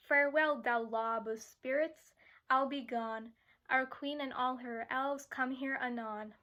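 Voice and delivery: projected, rhythmic monologue reading; verse recitation. Acted. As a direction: recite the fairy's lines clearly in short metrical phrases. Recites a monologue from Shakespeare's fairy.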